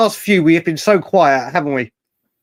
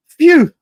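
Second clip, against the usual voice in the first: relieved phew; 12 semitones higher, 6 dB louder than usual. Retold or imitated. Relief at finding more sugar.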